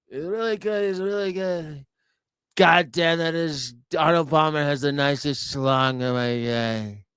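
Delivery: lightly voiced Trump with jaw pushed out; Trump impression. Streamer imitating Donald Trump, a hint of his voice. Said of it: "They always talk like this".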